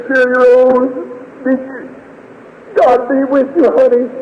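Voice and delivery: very high, tender grieving voice; tearful farewell. Retold or imitated. Father blessing his dying daughter, tearful. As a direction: bless the child through grief.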